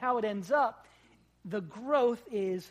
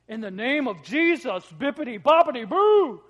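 hammed-up booming invocation, much louder; mock grand invocation; 3 semitones higher, 13 dB louder than usual. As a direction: proclaim it like a showman casting a spell. Disciples escalating a magic charm.

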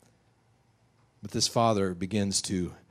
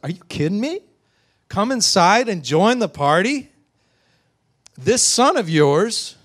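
high, loud incredulous outrage; furious; 9 semitones higher, 9 dB louder than usual. Acted. Older son answering his father's invitation, furious. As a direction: throw the invitation back in disbelief. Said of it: "And now he's furious".

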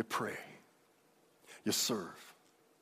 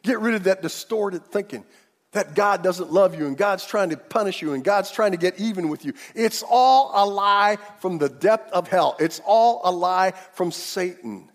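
full-force preaching; 6 semitones higher, 9 dB louder than usual. Acted. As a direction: preach it out to the whole church, stretching the big words.

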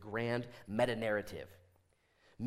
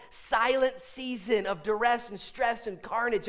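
ordinary talk, nothing marked

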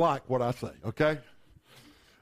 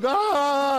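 high imitated cry; mock girl's wail; 6 semitones higher, 3 dB louder than usual. Little girl in the car, hammed-up wail. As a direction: wail like a girl in the back seat. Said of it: "and the little girl".

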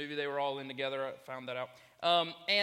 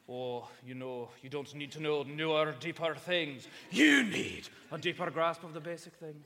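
imitating a pastor's Scottish accent, building louder; 4 dB louder than usual. Preacher quoting his former pastor to the congregation, Scottish accent. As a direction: put on a big Scottish accent, punching "You need". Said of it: "in his wicked, cool Scottish accent".

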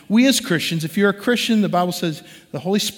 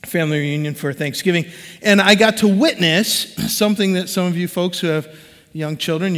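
ordinary talk

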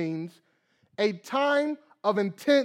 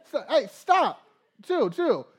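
laughing; 6 semitones higher, 5 dB louder, slower than usual. Retold or imitated. Calming the screaming son.

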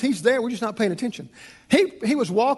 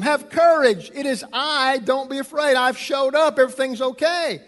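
raised, higher preaching voice; reassuring Jesus; 6 semitones higher than usual. Acted. Jesus calls to frightened disciples on the water, emphatic reassurance. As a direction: proclaim courage to the frightened. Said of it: "Immediately Jesus spoke to them".

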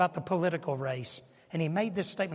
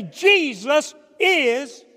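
Jesus is shouted slowly, high and loud; defiant shout; 8 semitones higher, 11 dB louder, much slower than usual. Retold or imitated. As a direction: shout out your faith defiantly. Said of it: "So they would say".

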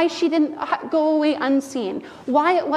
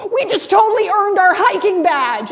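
put-on high, loud eager child voice; louder, higher kid boasting; excited kids; 6 semitones higher, 9 dB louder than usual. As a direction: play the proud little girl announcing her badge. Kids playing Girl Scout game.

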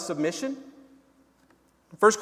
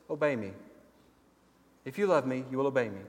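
gentle invitation; 5 semitones lower, 7 dB quieter, much faster than usual. Retold or imitated. God's gentle invitation contrasted.

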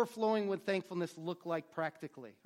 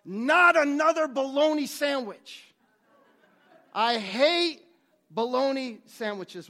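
loud, higher, exasperated complaint; acting out a worker fed up with his lunch; frustrated outburst; 5 semitones higher, 8 dB louder, slower than usual. Worker groaning over his lunch, loud and fed up. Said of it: "out of frustration he's like".

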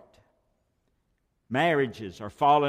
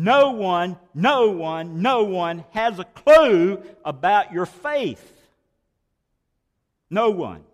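slow, loud, pounding repetition of no one; rising preacher's cry; preaching at full force; 5 semitones higher, 8 dB louder, slower than usual. Acted. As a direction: hammer each no one home. Preacher builds to a loud point.

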